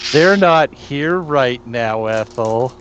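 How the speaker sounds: low, slow creepy whisper; slow and low, eerie and menacing. Acted. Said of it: "that creepy voice doesn't help you".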